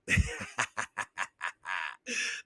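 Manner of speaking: low, soft run of chuckles; real laugh. Real, not acted. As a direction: chuckle helplessly at your own joke. Amused by the risqué word.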